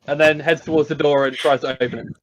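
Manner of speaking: usual speaking voice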